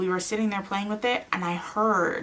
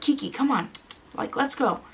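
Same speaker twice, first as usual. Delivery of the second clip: higher-pitched coaxing call to a pet; acted-out pet call, pitched up; calling the cat; 7 semitones higher than usual. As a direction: coax a cat to come along. Acts out calling her cat.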